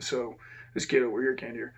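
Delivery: ordinary delivery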